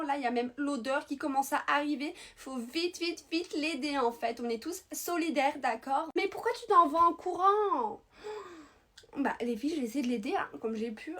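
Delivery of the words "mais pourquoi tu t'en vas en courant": loud, high call after someone running off; fake-innocent loud call; calling after her. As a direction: shout after her as she runs away. Calling after fleeing girl.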